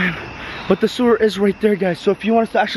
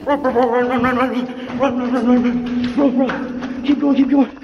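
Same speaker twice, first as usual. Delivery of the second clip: being chased; 6 semitones higher than usual. Real, not acted. Fleeing a peeking killer clown.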